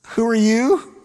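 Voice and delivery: mock puzzled king. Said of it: "he looks at her and says".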